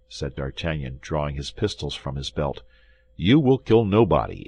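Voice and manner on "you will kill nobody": raised, commanding voice; emphatic order to stop; firm warning. A narrator's reading. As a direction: warn them off firmly and louder. Pistols drawn, warning the gentlemen.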